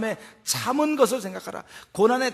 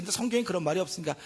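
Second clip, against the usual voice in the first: ordinary delivery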